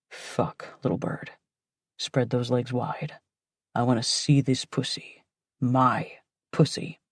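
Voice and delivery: low, hushed, commanding man; deep commanding voice. Acted. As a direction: order her, low and possessive. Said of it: "he orders in that deep voice".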